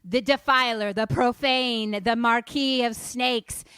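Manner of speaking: loud, slow litany of titles; loud, slow list of unholy titles; loud incantation. Retold or imitated. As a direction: chant the demon's names like a curse. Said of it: "And she says".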